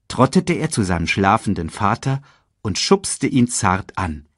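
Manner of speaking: ordinary delivery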